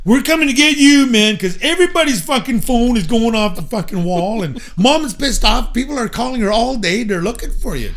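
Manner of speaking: high, loud hurried relay of news; excited brother. Retold or imitated. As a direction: blurt it out all worked up. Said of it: "He said".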